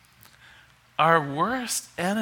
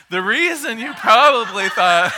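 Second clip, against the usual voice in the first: higher, louder, laugh creeping in; breaking into laughter; 6 semitones higher, 8 dB louder than usual. Real, not acted. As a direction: try to explain while cracking up. Cracking up explaining the joke.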